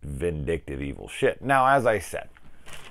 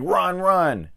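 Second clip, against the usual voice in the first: high, louder imitation of urging; urgent mock cry; 13 semitones higher, 6 dB louder than usual. Dying dude telling the girl to flee, high mock-urgent cry. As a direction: imitate a plea to flee, pitched way up. Doomed man urges her to flee.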